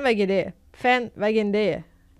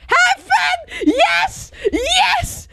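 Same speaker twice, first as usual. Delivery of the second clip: very high-pitched shrieks of joy; ecstatic screaming; much slower than usual. Real, not acted. Player greets Fan on finding them, ecstatic screaming. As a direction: scream in celebration, voice shooting right up.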